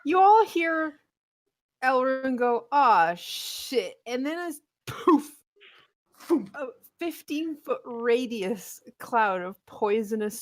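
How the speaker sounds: loud, slow, dramatic scene-setting; game master narration. Acted. Game master tells players of the spore cloud, loud dramatic narration. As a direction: draw out each beat of the disaster with punch.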